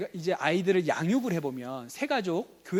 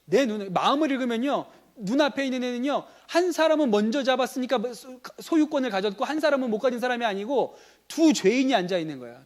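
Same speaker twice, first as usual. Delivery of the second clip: louder, driving sermon delivery, pressing each phrase; preaching at full force; 5 semitones higher, 5 dB louder than usual. Acted. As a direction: preach it out to the congregation. (힘 있게 설교하는 목소리)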